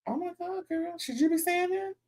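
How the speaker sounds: scandalised whisper-ish high voice; shocked aside. Retold or imitated. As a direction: breathe it out like you can't believe she said that. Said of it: "I'm like".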